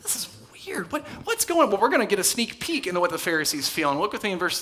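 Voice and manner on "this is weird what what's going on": baffled Pharisee. Acted. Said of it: "how's the Pharisee feeling".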